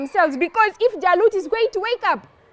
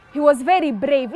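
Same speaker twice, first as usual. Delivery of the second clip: plain reading voice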